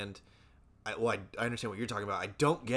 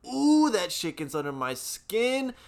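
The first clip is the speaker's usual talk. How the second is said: exasperated rant; 9 semitones higher, 7 dB louder, slower than usual. Real, not acted. Ranting about fake travel posts.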